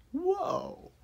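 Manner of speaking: high, sudden exclamation; surprised whoa. Real, not acted. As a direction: jump up into a startled whoa. Storyteller's surprised aside.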